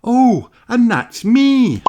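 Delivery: put-on little girl voice, high and slow; high slow young piglet. Young Mummy Pig on the old tape, high child voice. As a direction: coo it shyly.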